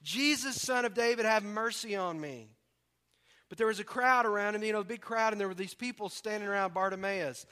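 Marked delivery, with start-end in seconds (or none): 0.0-2.5 loud, raised-pitch plea, preached out; shouting. Retold or imitated. Blind beggar calling to Jesus over a crowd, shouting. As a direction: call out for mercy, a moderately acted shout. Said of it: "he began to shout".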